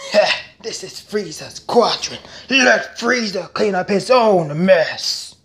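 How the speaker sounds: rapid-fire anime character voices; switching impression voices. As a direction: jump from villain to villain. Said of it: "if I do well, let me know".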